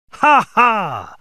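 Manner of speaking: loud, high burst of laughter; hearty laugh. A narrator's reading. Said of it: "laughed Fred".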